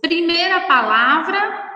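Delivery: ordinary talk, nothing marked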